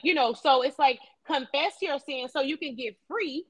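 ordinary talk

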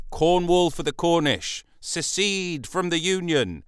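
high, drawn-out marching slogan; protesters' slogans. Retold or imitated. Marchers demanding Cornish independence, mock chanting.

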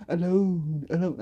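drawn-out "alone", fading away; cartoon echo. Joking nod to a SpongeBob episode, fading echo. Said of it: "I was just watching that SpongeBob episode".

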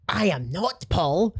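stiffly offended declaration; a hammed-up affronted Brian; mock indignant correction. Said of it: "he's not said it like an arsehole".

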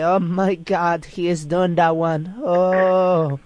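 high, drawn-out, scandalized Becky; mock Becky. Guest mimics Becky reacting to them saying one thing, mock shock. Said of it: "Becky is gonna come out here and say".

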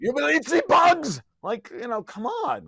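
mock German villain, slow and high; bad German accent. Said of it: "bad German accent".

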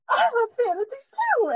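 overjoyed, almost tearful agreement; squealing excitement. Retold or imitated. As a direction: gush it like a thrilled superfan.

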